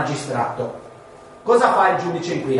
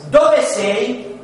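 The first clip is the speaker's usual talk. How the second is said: a higher, slightly raised call; mild calling out; 6 semitones higher, 4 dB louder than usual. Acted. God calls to the human in the garden, mildly calling out. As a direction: call it out like a question from on high. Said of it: "gridò".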